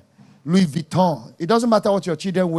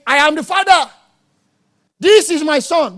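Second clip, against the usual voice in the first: God the Father's words; 6 semitones higher, 6 dB louder than usual. Acted. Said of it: "The Father didn't come saying".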